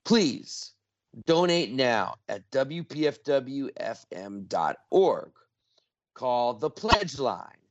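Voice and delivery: slow, deliberate read-out for listeners; super slow. Real, not acted. Radio host asking listeners to donate, super slow. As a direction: give out each detail slowly, with pauses. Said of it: "I'm gonna repeat this super slow".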